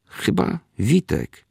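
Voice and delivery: slow, high, wondering guess of a name; a man at the station, half-sure he knows the traveller, slow and wondering; surprised recognition. Retold or imitated.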